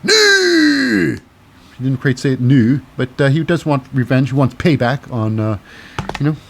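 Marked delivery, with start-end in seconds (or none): 0.0-1.2 high, slow mock Scottish cry; hammed-up Scottish accent, vowels stretched high; Sheamus, Scottish accent. Sheamus demands revenge for his friend, high exaggerated Scottish accent. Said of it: "why he's got a Scottish accent".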